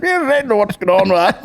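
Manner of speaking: high, loud mock old man; old boy voice. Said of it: "he was like".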